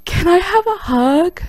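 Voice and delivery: slow high-pitched timid ask; timid high plea. Retold or imitated. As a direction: ask like a starstruck kid. Timid fan asks for hug.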